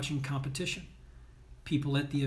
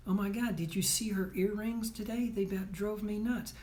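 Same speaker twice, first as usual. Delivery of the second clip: higher, gushing mock-girl voice; gossiping girl; 5 semitones higher than usual. Coach mimicking a girl fussing over earrings, higher gushy voice. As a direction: ham up a teen girl griping about earrings.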